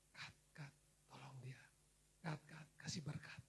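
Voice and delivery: unvoiced whisper calling the angel; whispered command. God whispers to his angel, quiet urgent whisper. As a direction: whisper the order softly.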